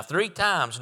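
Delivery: ordinary talk, nothing marked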